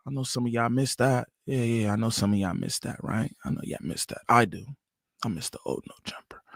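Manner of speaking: low and soft, sinking to a whisper; hushed confiding aside. Real, not acted. Host admitting he misses the old show, hushed aside. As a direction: drop down low and fade into whispering.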